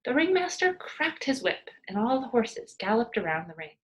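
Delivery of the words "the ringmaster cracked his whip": plain reading voice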